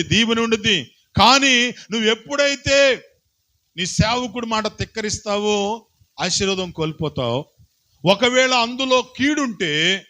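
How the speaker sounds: high, loud impassioned preaching; fiery sermon voice; preaching at full force. Acted. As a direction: drive the warning home to the congregation. Preacher raising voice on obedience.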